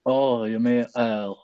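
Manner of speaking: usual speaking voice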